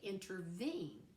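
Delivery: "intervene" slowly, stress on VENE; stress on third syllable. Shown as a demonstration. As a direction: stretch out the last syllable of "intervene". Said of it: "three syllables with a stress on the third one".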